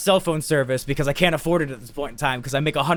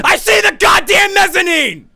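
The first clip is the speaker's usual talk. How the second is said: high, slow, hammered-out shout; outraged yell; 8 dB louder, slower than usual. Retold or imitated. Lost man finally spots the mezzanine, outraged yell. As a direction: bellow the punchline, every word stretched. Climactic reveal of the story.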